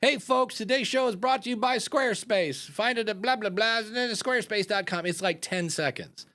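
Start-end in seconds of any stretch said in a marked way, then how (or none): 0.0-5.0 ad read. Retold or imitated. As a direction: sell it like a quick podcast sponsor spot. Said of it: "I like Mark Maron's pre-roll".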